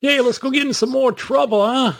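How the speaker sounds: 1940s New York gangster voice; old-time radio New York tough guy; New York accent. Retold or imitated. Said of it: "very New York accent".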